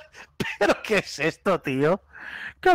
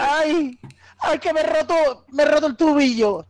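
whiny; 9 semitones higher than usual. Pretend. Mock injury whining.

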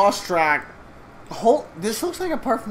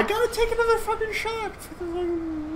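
squeaky voice; 5 dB quieter than usual. Real, not acted. Squeaky outburst while laughing.

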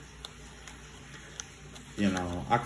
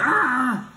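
high loud mocking ah; one kid mocking the teacher, high and loud; mock yell; 6 dB louder than usual. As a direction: shout the ah high to prank him.

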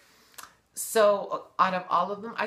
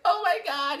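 mock shocked squeal; 11 semitones higher, 3 dB louder than usual. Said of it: "then she's like".

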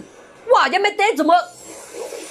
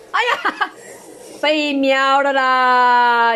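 drawn-out laughing dismay; laughing groan; much slower than usual. Real, not acted. Gamer laughing as his hero gets wiped, slow laughing groan. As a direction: laugh it off as he loses.

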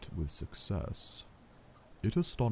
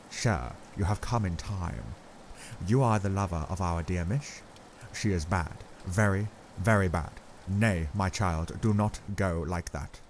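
put-on grave old Dutch professor, accented, measured; Van Helsing's accent. A narrator's reading. Professor gravely telling Arthur Lucy is very bad, accented and grave.

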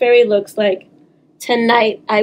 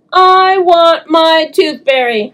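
slow, louder whiny demand; stomping demand; 5 semitones higher, 4 dB louder, slower than usual. Acted. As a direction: demand it like a stubborn child. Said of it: "stomping my foot".

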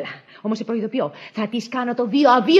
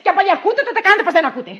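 loud, indignant telling-off; irritated outburst; 5 semitones higher, 6 dB louder, faster than usual. Acted. Mistress rebukes the eavesdropping maid, loud and irritated. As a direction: tell her off at raised volume.

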